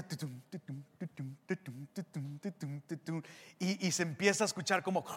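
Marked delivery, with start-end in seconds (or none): 0.0-3.2 soft, low "tu-tum" pulse; quiet repeated rhythmic thumps; imitated music intro. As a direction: mimic an ominous bass beat under the breath. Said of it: "en la música empieza".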